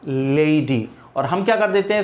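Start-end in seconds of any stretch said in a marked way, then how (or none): 0.0-0.9 लेडी said as "lei-di" with a long ei glide, falling; teacher models लेडी with a clear lay-dee diphthong; लेडी as "lay-dee", slow and falling; correct lady. Shown as a demonstration. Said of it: "इस तरीके से pronounce होता है".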